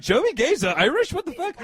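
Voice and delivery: high, loud incredulous disbelief; retold shock. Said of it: "I was like".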